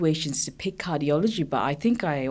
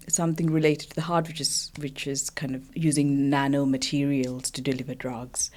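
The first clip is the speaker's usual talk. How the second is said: ordinary talk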